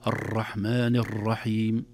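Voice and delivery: drawn-out "الرحمن الرحيم" with each ر rolled too many times; over-trilled R. Shown as a demonstration. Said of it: "المبالغة في تكراره".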